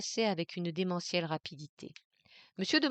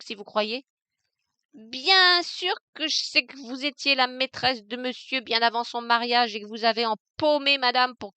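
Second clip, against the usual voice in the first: loud, higher, brazen and cutting; insolent defiance; 4 semitones higher, 7 dB louder than usual. Acted. Maid exposing her mistress, loud and insolent. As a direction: throw the secrets in their faces. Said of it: "L'insolente et gouailleuse voix".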